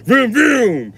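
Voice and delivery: loud rising vroom then falling; imitated engine rev. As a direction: rev the engine with your mouth. Revving car engine.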